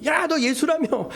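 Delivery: high-pitched taunting from a crowd; mocking jeer. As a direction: dare him with open scorn. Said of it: "사람들이 다 조롱해요".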